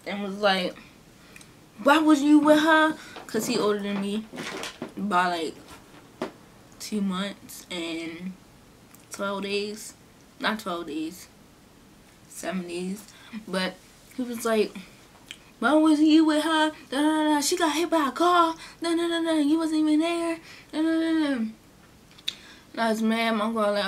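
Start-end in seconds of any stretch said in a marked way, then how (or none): 1.8-3.0 angry demand. Retold or imitated. Uncle scolds cousin on phone. 15.6-21.3 angry scolding. Retold or imitated. Uncle scolds cousin on phone.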